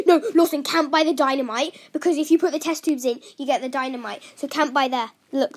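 ordinary delivery

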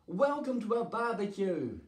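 high, hearty storybook greeting; high-pitched announcing greeting; dragons' welcome. A narrator's reading. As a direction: call out a welcome, voice lifted.

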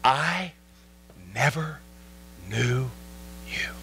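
low, one word at a time; grave verdict with long pauses between words; solemn Jesus. Acted. Jesus turning away false believers, solemn and low. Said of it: "and Jesus will say".

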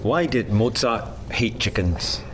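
ordinary delivery

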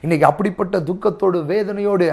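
ordinary delivery

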